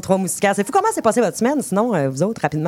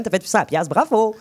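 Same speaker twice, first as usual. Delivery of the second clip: usual speaking voice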